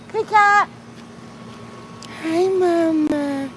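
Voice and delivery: very high slow cooing; drawn-out high coo to the cat; sing-song pet greeting. Real, not acted. Praising cat.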